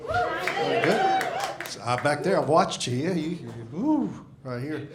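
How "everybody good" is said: squeaky high. Shown as a demonstration.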